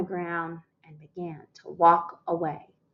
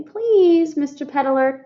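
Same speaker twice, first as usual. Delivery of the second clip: mock polite please; 8 semitones higher, 3 dB louder, slower than usual. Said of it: "You should say".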